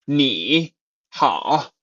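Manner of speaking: 你好 with both syllables dipping and rising; two full third tones. Shown as a demonstration. Imagining a laborious greeting, two full third tones in 你好. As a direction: labour through a full dip on 你, then on 好. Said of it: "That would be too constraining".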